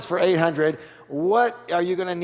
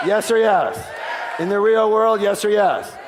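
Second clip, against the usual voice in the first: rallying shout; 5 semitones higher, 4 dB louder than usual. Real, not acted. Speaker working up the crowd.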